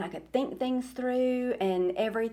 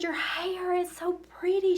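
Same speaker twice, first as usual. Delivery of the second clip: much higher sweet imitated voice; imitating the lady; 11 semitones higher than usual. High sweet imitation of volunteer praising her hair. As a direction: play the gushing volunteer lightly, high up. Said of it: "She said".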